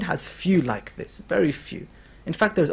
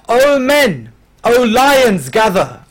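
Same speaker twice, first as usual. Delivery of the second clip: loud rallying call, raised pitch; 5 semitones higher, 8 dB louder, much slower than usual. Acted. Habib rallying his companions, a loud call. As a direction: summon the men like a commander.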